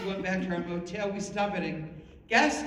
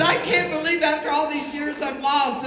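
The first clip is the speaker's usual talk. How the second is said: loud, raised mock wail; despairing wail; 5 semitones higher, 5 dB louder than usual. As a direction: lament it as a hysterical mother, for laughs. Retold mom despairing in hysteria.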